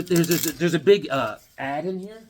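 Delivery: ordinary talk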